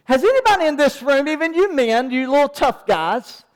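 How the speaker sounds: ordinary talk, nothing marked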